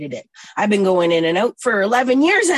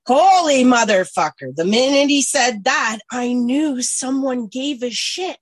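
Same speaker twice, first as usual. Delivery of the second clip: loud, higher-pitched exclamation; astonished outburst; 5 semitones higher, 6 dB louder than usual. Real, not acted. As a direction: burst out, stunned and thrilled. Astonished climax of her story.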